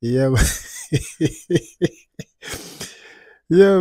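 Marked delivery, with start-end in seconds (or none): 0.5-1.6 short breathy chuckle; amused laugh. Real, not acted. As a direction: laugh it off between remarks. Host amused after commentary.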